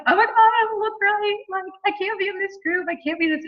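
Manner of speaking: high, breathy-free airhead squeal of panic; valley girl. Retold or imitated. Mocking a blonde team girl who fears she doesn't fit, high airhead voice. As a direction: play a ditzy blonde team girl fretting. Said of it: "I'd be like".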